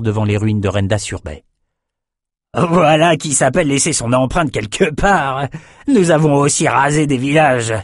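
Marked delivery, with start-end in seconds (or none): 2.5-7.7 high, gleeful brute raider; awed raider. Acted. Raider marveling at burnt city, gleeful brute. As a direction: gawk at the ruins. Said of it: "les Norukai s'ébahirent".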